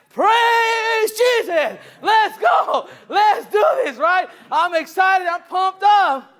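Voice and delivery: very high, loud, drawn-out shout; ecstatic shout. Retold or imitated. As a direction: scream it like a revival. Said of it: "And I'm like".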